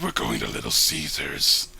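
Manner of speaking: hammed-up domineering partner; mock abusive partner. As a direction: boom it down like a bossy brute. Voicing abusive partner, deep.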